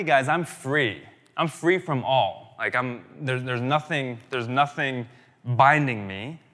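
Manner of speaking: slow, emphatic retelling of a proclamation; emphatic declaration. Retold or imitated. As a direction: declare it firmly, leaning on each key word. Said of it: "So basically saying, emphatically".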